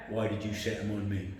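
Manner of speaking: imitated boss, low and subdued; low menace. Boss questioning his employee, low menace.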